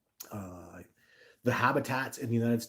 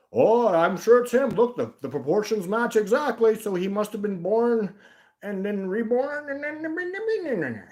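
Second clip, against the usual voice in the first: high, loud put-on silly voice; hammed-up reasoning that trails into a slow, drawn-out high note; mock believer; 10 semitones higher, 6 dB louder, slower than usual.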